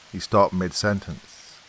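ordinary delivery

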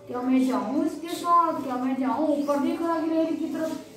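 ordinary delivery

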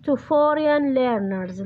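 very slow, word by word, for writing down; slow dictation. Shown as a demonstration. Teacher dictating the answer to her students, slow and deliberate. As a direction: spell it out slowly so students can copy.

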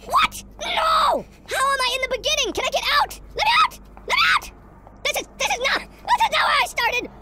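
high-pitched outcry, desperate to get out; panicked shouting. Real, not acted. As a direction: shriek it in real panic. Panics at being back at start.

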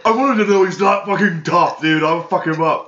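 high, loud parody of a furious tough talker; mock angry rant. Mockingly parodying co-host's angry rant.

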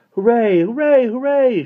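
high, loud cheers; delighted shout. A narrator's reading. Michael cheering Mary Poppins's feat, delighted shout. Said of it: "shouted Michael delightedly".

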